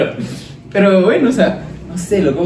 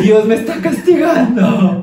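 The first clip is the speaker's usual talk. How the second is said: higher, louder, slow mock despair; hammed-up child's lament; guilty lament; 9 semitones higher, 7 dB louder, slower than usual. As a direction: wail it like a doomed kid. Kid's guilty lament, acted.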